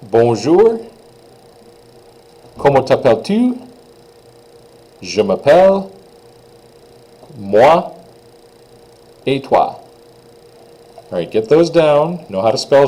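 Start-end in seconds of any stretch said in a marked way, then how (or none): 0.0-9.8 repeat-after-me model. Shown as a demonstration. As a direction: clear teacher model phrases, pauses for repeating. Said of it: "just repeat after me".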